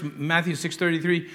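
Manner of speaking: ordinary talk, nothing marked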